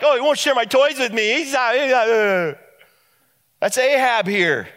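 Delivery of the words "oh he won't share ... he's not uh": put-on whiny little kid. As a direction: pout like a kid denied his toys. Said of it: "It's just like this little kid".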